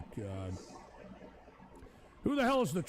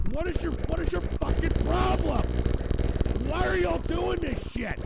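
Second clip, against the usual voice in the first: louder, exasperated demand; angry outburst; 3 semitones higher, 6 dB louder than usual. Real, not acted. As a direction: demand an answer, fed up. Streamer yells at the trolls again.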